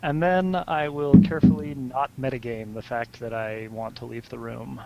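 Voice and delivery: normal talking voice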